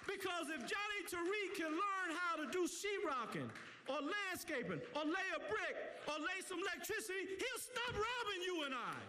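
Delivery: impassioned community preacher; fired-up speech from the pulpit; preaching. Real, not acted. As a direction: drive each point home to the crowd in rolling phrases.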